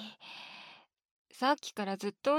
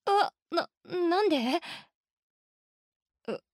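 ordinary delivery

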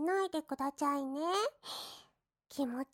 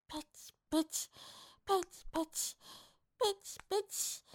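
playful slap sounds; 3 semitones higher, 5 dB quieter, faster than usual. Acted. Mock slapping his cheeks.

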